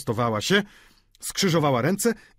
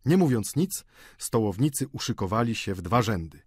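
plain reading voice

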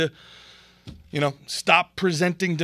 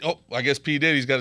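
ordinary delivery

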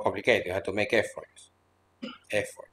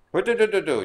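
much higher playful babble; mock Hindi chatter; 9 semitones higher, faster than usual. Teacher imitating Hindi speech he only hears as noise, playful. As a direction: imitate foreign-sounding noise. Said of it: "yes, in Hindi".

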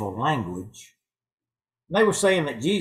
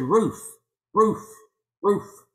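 lightly voiced dog bark, higher-pitched; 7 semitones higher, 4 dB louder, much slower than usual. Talking dog answers its owner's question, light barking. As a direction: say it like a dog's short bark, pitched up.

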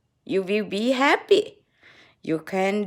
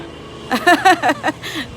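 higher-pitched rippling laugh; 5 semitones higher than usual. Real, not acted. Joking about holidaying at the hospital, real laughter. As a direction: laugh in a long, quick run.

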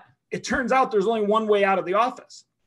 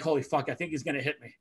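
nervous; 6 semitones lower, 6 dB quieter, faster than usual. Retold or imitated. Fearful inner thought.

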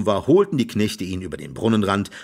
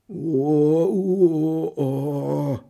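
long, high drawn-out wail; mock wailing moan, held long; 6 semitones higher, much slower than usual. A narrator's reading. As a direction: howl a sustained "oh". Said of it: "heulte Hans".

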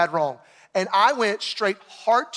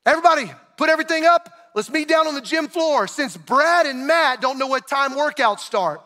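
fast, loud, higher coach's call to the team; annoyed coach; 5 semitones higher, 4 dB louder than usual. Retold or imitated. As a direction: bark it across the gym like a fed-up coach. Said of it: "Coach turns and he just blows the whistle".

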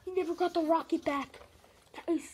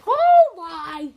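surprised; 2 semitones higher, 14 dB louder than usual. Real, not acted. Surprise in game.

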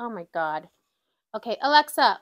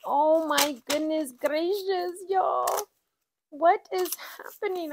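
real excited squeal, much higher; overwhelmed squeal; 7 semitones higher than usual. Real, not acted. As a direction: squeal it out in disbelief. Overwhelmed by unexpected savings total.

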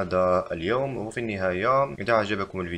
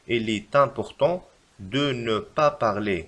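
very slow, in two chunks; slow dictation; much slower than usual. Shown as a demonstration. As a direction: dictate the correction slowly. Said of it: "فالتصحيح لهذه الجملة يجب كتابة".

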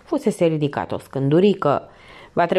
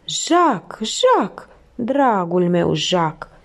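a slow, despairing call of the name; desperate cry; much slower than usual. A narrator's reading. Felicie calling for her wounded Jacques, in despair. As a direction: cry out for a loved one, drawn out. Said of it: "strigă disperată".

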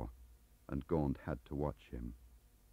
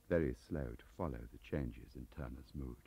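usual speaking voice

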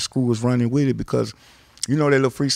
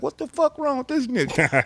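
higher, incredulous outburst; mock bewildered Zero; baffled; 9 semitones higher than usual. Zero watching Al hit walls, baffled. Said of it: "Zero was like".

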